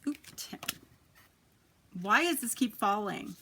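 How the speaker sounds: ordinary delivery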